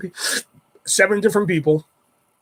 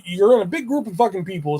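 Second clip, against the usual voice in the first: usual speaking voice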